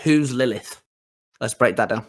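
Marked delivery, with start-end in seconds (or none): none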